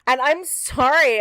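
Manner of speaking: ordinary delivery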